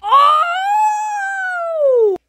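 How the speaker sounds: loud, high yelling on the run; frantic shrieks while fleeing; high panicked shout. Acted. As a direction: scream while running away. Fleeing a fight.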